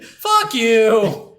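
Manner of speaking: loud, high cursing at the game; mock rage at game. Gamer retelling how he cursed out the boxing game, loud mock rage. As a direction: yell it at the screen like you've lost it.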